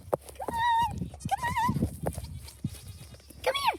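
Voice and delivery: very high sing-song call while running; calling goats. Real, not acted. Girl running and calling her goats to follow, very high sing-song call. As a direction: squeal it out to lure the goats. Watch, if I start running, they'll come.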